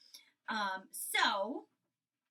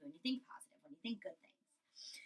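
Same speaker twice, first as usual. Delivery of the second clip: whispered aside; 6 semitones lower, 8 dB quieter than usual. Real, not acted. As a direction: drop to a breathy whisper. Teacher softly explains to class.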